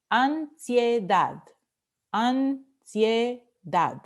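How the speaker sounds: "Ansiedad" slowly split into an, sie, DAD; syllable by syllable. Shown as a demonstration. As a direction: sound out "ansiedad" slowly in three beats, stressing DAD. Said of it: "Última sílaba".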